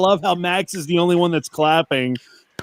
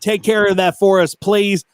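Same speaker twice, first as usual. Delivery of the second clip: ordinary delivery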